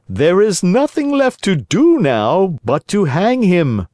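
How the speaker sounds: high, cold villain voice; grim assassin. A narrator's reading. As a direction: settle the puppet's fate.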